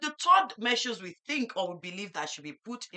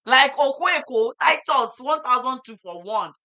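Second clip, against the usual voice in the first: shocked outcry; 5 semitones higher, 9 dB louder than usual. Real, not acted. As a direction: exclaim it, stunned by the cost. Shocked at market price.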